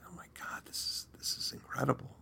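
hushed, breathless whisper of amazement; awed whisper. Retold or imitated. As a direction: whisper it as if afraid to break the silence. Silent control room, awed reaction.

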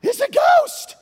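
high, very loud panicked shriek; hammed-up terrified yell; terrified ghost shriek. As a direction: scream it like you've seen a ghost. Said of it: "they were terrified".

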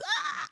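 very high thrilled squeak; a sudden little yelp of joy; excited squeal. Real, not acted. Excited squeal after quote.

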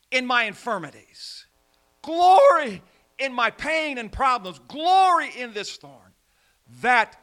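loud, high, slow rising cry of glory; preaching at full force. Acted. Paul glories in his thorn, loud fervent preaching. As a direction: swell it up to a fervent climax.

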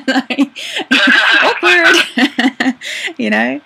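high, loud word through laughter; laughing. Real, not acted. Laughing at fans declaring love.

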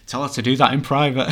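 laughing while passing on the joke; laughing quote. Retold or imitated. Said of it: "He's laughing about that".

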